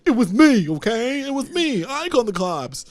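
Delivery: high-pitched, hammed-up confession; imitating an earnest, overwrought admission; Archie. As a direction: own up in a mock-dramatic voice.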